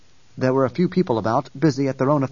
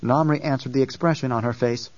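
usual speaking voice

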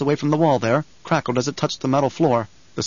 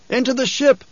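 urgent shout; 11 semitones higher, 3 dB louder than usual. A narrator's reading. Officer shouts.

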